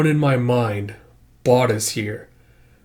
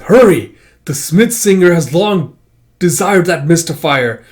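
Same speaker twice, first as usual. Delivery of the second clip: loud, raised villainous proclamation; urgent command; 5 semitones higher, 7 dB louder than usual. Acted. Masked hunter urging others through the woods, loud command. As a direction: call out to hurry the hunt.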